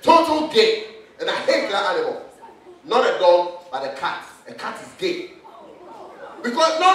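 ordinary talk

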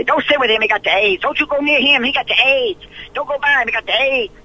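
high, jeering schoolyard taunt; mock the cruel inmates in a high sneer; taunting prisoners. Prisoners taunting, voiced in story.

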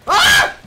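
high, loud shriek; startled scream. Real, not acted. Player caught by zombies, startled shriek. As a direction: shriek in sudden fright.

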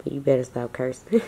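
whispering the mom's line; whispered. Retold or imitated. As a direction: read it back in a hushed voice.

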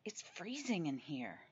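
soft, feeble whine; Clifton Harris whine. Acted. Pretend patient whining to dad in hospital. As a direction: complain weakly, like an ill son. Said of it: "I whined to Otter".

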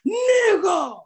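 high, loud hollered greeting. Retold or imitated. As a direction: imitate an excited fan shouting hello.

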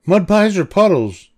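put-on high girl's voice; playful big sister. Lucy inviting her sister to play, high playful voice. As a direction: offer it playfully, pitched high.

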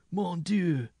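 high, loud cry of shock; gasped exclamation. A narrator's reading. Minstrel regains his saddle after the attack, gasping. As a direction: exclaim as if just escaped death. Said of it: "gasped the minstrel".

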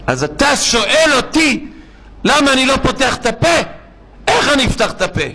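indignant outburst. Retold or imitated. Patient answering the Haredi man at Tel Hashomer, indignant outburst. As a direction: act out an incredulous outburst, pitch jumping up. Sick man snaps at questioner.

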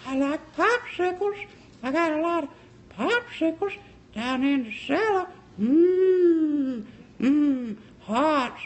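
put-on very high, slow, thin old man; old man from Family Guy. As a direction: savour each word in a creepy cartoon falsetto. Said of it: "the old man from Family Guy, he's like".

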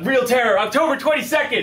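high, loud, pumped-up announcement; excited hype. Shown as a demonstration. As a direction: sell it with big energy. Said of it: "Say it again".

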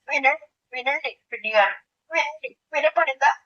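ordinary talk, nothing marked

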